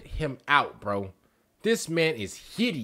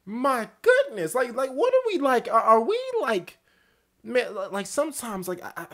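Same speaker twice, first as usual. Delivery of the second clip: fading disbelief; 7 semitones higher than usual. Real, not acted. Outrage fading out.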